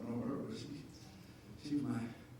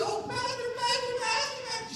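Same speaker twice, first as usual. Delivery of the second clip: high, pleading call of her pastor; emotional church lady; 14 semitones higher than usual. Retold or imitated. Sister Brenda calling her pastor, high and emotional.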